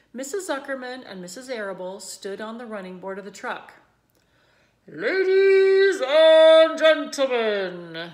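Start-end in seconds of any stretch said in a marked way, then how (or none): 4.9-8.1 sung-out, far louder, slow PA call; grand announcer. A narrator's reading. Announcer calling the crowd to attention, loud and sung. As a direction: stretch it out like a ringmaster. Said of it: "said the loudspeaker".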